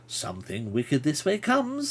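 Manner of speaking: slow, drawn-out creature voice; little man's voice. Acted. Little man warns the traveller at his feet, slow creature voice. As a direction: draw it out like a little storybook creature.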